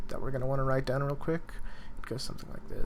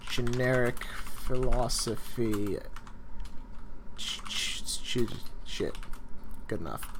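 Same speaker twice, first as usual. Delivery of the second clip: ordinary delivery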